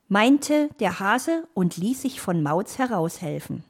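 ordinary delivery, nothing marked

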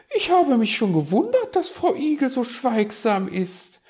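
very high, loud magpie voice; flustered magpie excuse. Acted. Magpie caught in the raven's nest, making excuses. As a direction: bluff your way out in a high squawk.

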